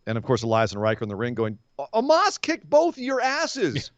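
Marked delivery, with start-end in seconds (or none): 1.8-3.9 hammed-up outrage at the tag champs; mock indignant wrestler. Voicing Elias and Riker.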